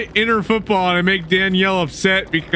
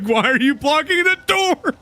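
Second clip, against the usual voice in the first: mock annoyed; 10 semitones higher than usual. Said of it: "You're just like".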